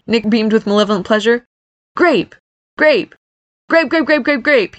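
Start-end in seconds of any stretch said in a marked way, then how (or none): 2.0-4.8 high, repeated falling jabs; taunting. Acted. Nick taunts brother with his new name, taunting. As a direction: fire off each word as a teasing jab. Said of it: "he taunted".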